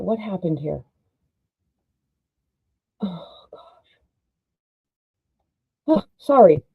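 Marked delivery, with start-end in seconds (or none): 3.0-4.0 whispered frustration. Real, not acted. Frustrated under breath after mistake.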